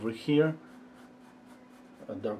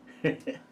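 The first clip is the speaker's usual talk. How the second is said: quick amused breath of a laugh; little laugh; 4 semitones higher than usual. Real, not acted. Teacher promising to show viewers, small chuckle. Teasing viewers about the answer.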